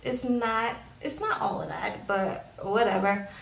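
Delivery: ordinary talk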